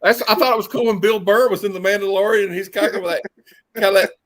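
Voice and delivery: ordinary talk, nothing marked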